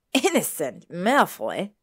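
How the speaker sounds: loud, incredulous cry. A narrator's reading. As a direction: cry it out in disbelief. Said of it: "Dennis cried".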